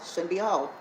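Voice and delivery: normal talking voice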